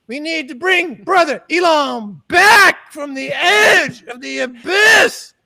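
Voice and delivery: rising sermon that builds to a shout; mock preaching. Mock preacher calling to bring Brother Elon back, building to a shout. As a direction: preach it like a revival pastor.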